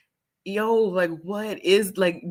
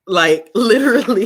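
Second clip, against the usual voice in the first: laughing; 2 semitones higher, 9 dB louder than usual. Real, not acted. Laughing at absurd book reward.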